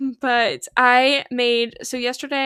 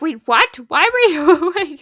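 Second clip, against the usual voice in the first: retold surprise; 4 semitones higher, 8 dB louder than usual. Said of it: "but he was like".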